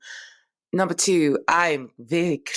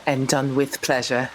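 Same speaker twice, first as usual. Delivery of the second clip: ordinary talk, nothing marked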